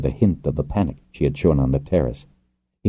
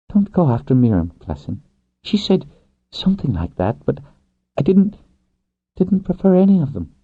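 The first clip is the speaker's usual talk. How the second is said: higher, halting woman's voice; woman rider, defensive; 12 semitones higher than usual. Acted. Rider defending Miriam to her lover, defensive. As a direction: play her protesting in a higher register.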